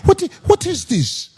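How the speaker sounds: the Nigerian voice in his head, singing it out; high sing-song Nigerian accent. Retold or imitated. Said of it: "my Nigerian voice kick in my head".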